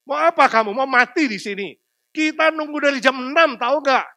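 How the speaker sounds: angry crowd. Retold or imitated. Angry crowd confronting man.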